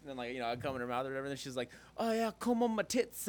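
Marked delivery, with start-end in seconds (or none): 2.0-3.3 high-pitched mock English woman; badly faked Englishwoman; bad English accent. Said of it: "that's a perfect English accent for her".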